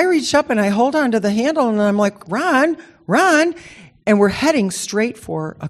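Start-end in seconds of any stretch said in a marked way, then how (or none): 2.3-3.5 higher urgent calling out to a driver; mock alarmed cry of a name. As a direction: call out to get their attention, higher. Alarmed call in retold dream.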